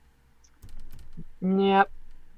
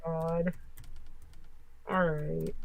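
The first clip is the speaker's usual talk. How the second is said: usual speaking voice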